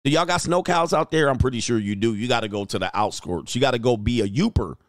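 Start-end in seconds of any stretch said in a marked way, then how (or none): none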